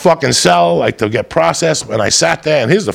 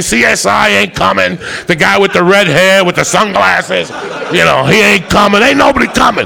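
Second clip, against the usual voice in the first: mock the cops mockingly; 6 semitones higher than usual. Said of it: "The cops say".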